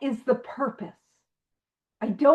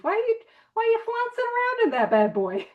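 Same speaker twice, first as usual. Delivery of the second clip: mock scolding voice; 8 semitones higher, faster than usual. Mock scolding the tulip trouncer.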